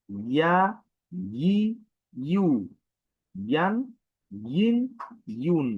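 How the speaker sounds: ইয়া ই ইউ ইয়ান ইন ইউন pushed out with pressure; forceful recitation of ইয়া ই ইউ; pressed vowel drill. Shown as a demonstration. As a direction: press each sound out for the class. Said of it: "আবার বলি".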